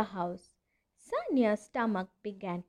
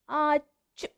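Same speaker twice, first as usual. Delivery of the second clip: a higher, hammed-up sneeze; 5 semitones higher than usual. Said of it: "sneezed Rohan again".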